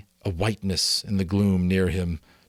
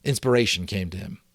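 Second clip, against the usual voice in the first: plain reading voice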